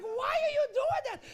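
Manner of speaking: put-on high, loud old Italian woman; hammed-up exasperated old woman; upset old lady. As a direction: wail it as a bewildered old lady. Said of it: "She was like".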